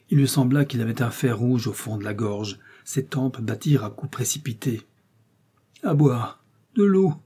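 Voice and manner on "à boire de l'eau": higher, louder parched moan; moaning plea. Acted. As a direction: beg for water, suffering. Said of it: "ne put-il s'empêcher de gémir".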